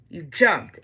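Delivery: ordinary talk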